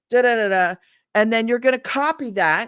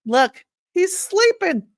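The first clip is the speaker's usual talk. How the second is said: tender coo; 11 semitones higher, 5 dB louder, slower than usual. Real, not acted. Mom showing viewers her sleeping son, high tender coo. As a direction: melt a little, pointing him out.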